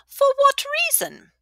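high, loud, cackling tavern woman; boisterous old widow. A narrator's reading. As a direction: hail an old friend across the room. Widow curious.

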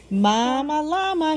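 plain reading voice

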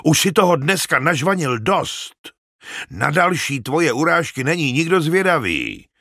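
angry, forceful rebuke; snapping angrily. A narrator's reading. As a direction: berate him with heated impatience. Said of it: "osopil se na něj Tárik".

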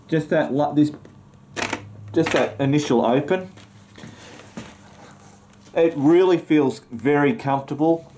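muffled behind helmet chin bar; speaking inside closed helmet; through a full-face helmet. Real, not acted. Seen 0: Helmet worn on head, chin bar over mouth throughout.

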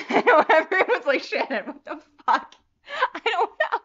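loud, high, laughing exasperation; helpless laughter. Real, not acted. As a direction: squeal it through helpless laughter. Laughing helplessly at webcam failure.

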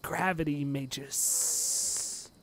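drawn-out sibilant grumble; glaring hiss. Acted. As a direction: draw out the final S in disdain. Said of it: "he would be like".

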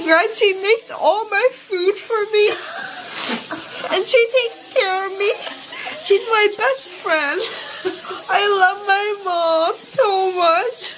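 real crying, loving and slurred; weepy affection. Real, not acted. Daughter telling how mom cares for her, weepy. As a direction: gush tearfully through a numb mouth.

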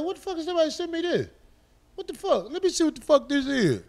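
high, fast retold disbelief; baffled outburst. Recalling when somebody sent him the clip, high-pitched and baffled. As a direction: replay your bafflement in a rushed, high voice. Acting out his reaction to message.

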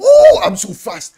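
loud, slow, high bragging shout; gloating shout. Real, not acted. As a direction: crow it out like you just won. Gloating after answering first.

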